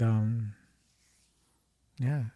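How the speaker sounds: ordinary talk, nothing marked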